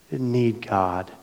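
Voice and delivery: ordinary talk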